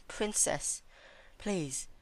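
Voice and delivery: high, hushed, frightened begging; quiet pleading. Acted. Celestia pleading with Twilight for mercy, quietly terrified. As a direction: beg softly so as not to provoke. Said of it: "she pleaded, trying to do so quietly".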